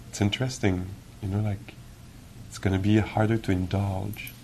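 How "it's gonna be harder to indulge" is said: normal talking voice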